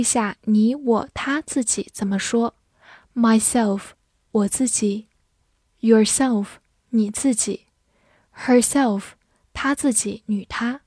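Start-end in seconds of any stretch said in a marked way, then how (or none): none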